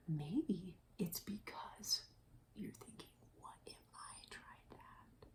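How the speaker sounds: slow whisper, fading away; hushed wondering. Pretend. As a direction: let the idea sink to a secretive whisper. Hints at animals' secret idea.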